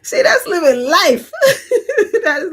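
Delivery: talking through big laughter; laughing. Real, not acted. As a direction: laugh it out, high and loud. Laughing at a funny story.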